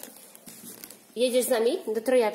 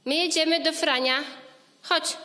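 calling out; 5 semitones higher than usual. Real, not acted. Mother urging toddler to come.

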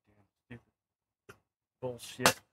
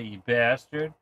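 ordinary talk

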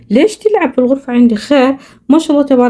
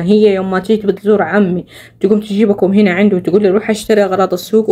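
ordinary talk

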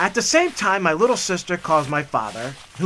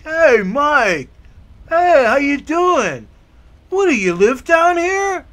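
higher, louder surprised-guy voice; excited greeting; 6 semitones higher, 4 dB louder, slower than usual. Retold or imitated. Tall guy in the basement, cheerfully amazed. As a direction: greet him like an overjoyed visitor.

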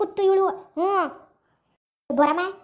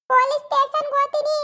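wailing threat; 7 semitones higher than usual. Acted. Beaten woman threatens her attacker with the police, high and wailing. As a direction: wail that you'll go to the police.

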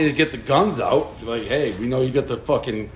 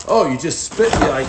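normal talking voice

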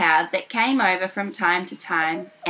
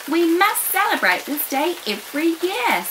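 excited; 9 semitones higher than usual. Acted. Another elephant proposes eagerly.

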